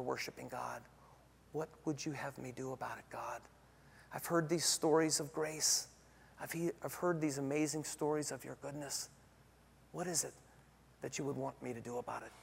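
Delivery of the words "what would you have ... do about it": soft, hushed prayer to God; quiet prayer. Acted. Pastor voicing the congregation's question to God, soft hushed prayer. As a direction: pray it softly, inward and searching.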